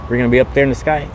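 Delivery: ordinary talk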